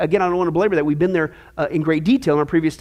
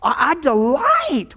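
slow, high, savoured "delight"; dwelling fondly on "delight"; drawn-out delight; 6 semitones higher, much slower than usual. Acted. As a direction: stretch "delight" out with relish. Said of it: "verse eight says this".

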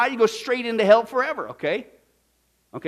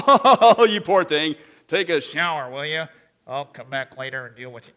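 mock dismissive; 7 semitones lower, 5 dB louder than usual.

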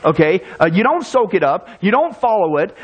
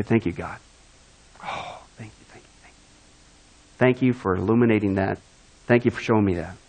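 softer, lower breathy thanks to God, fading to a whisper; hushed grateful prayer; 10 semitones lower, 6 dB quieter than usual. Retold or imitated. Convicted believer thanking God, whispering softly. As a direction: pray it quietly, deeply grateful.

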